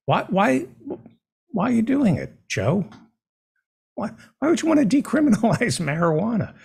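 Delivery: mock-outraged, high-pitched protest; sarcastic. Host questioning Joe over decriminalizing marijuana, sarcastic. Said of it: "I'm being sarcastic".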